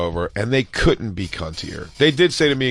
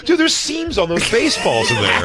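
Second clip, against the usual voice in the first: mock stoned awe; 9 semitones higher than usual. Mocking someone high marvelling.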